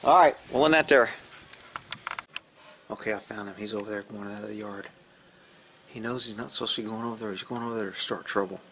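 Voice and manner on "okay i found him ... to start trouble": much softer, low-voiced spying remarks; hushed commentary. Real, not acted. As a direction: keep it down so the cat won't hear. Spying on the cat quietly.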